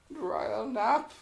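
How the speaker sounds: high, grand announcement; mock grand. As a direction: say it like a royal title. Playful grand phrase.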